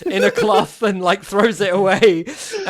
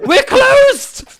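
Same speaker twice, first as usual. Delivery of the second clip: high, loud, breathless panic; hysterical shopkeeper; 13 semitones higher, 5 dB louder than usual. Acted. Shopkeeper, shaken, ordering the shop closed in a high panic. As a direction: yelp then order everyone out. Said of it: "looks at you and just goes".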